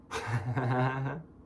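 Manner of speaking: a short, high laugh; real laugh. Real, not acted. Ranting at an absent man, laughing. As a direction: laugh climbing in pitch at the end.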